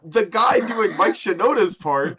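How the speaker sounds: high, loud outburst; exasperated rant. Real, not acted. Ranting about singer's botched verse.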